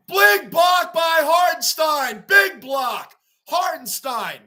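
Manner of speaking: loud, high play-by-play shout; excited play call. Real, not acted. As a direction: shout the block as it happens, drawn out. Calling a big play live.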